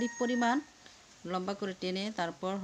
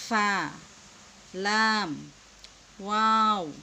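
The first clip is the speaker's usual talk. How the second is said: loud, stretched Arabic letter names; drawn-out letter recitation; 5 dB louder, much slower than usual. Shown as a demonstration. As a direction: call out each letter long and clear like a Qaida teacher. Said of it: "আমরা এলোমেলো করে পড়লাম". (টেনে টেনে জোরে হরফ পড়া)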